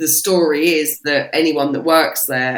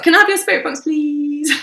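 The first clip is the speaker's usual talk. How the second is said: pleading; 11 semitones higher, 3 dB louder than usual. Retold or imitated. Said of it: "I was like".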